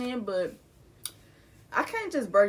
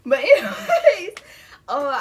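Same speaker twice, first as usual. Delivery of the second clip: laughing; 6 semitones higher, 11 dB louder, slower than usual. Real, not acted. Laughing through grief.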